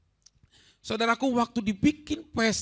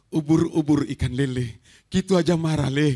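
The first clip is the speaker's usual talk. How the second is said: teasing father; 5 semitones lower, 5 dB louder than usual. Retold or imitated. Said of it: "Bapaknya ngomong gini".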